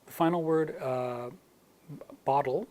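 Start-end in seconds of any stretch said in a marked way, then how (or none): 2.2-2.7 bottle with a slight D sound; American flap T. Shown as a demonstration. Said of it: "American English with that slight D sound".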